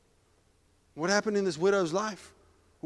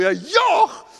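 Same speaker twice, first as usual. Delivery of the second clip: comic yelp; 12 semitones higher, 11 dB louder than usual. Acted. Comic reaction after heart attack.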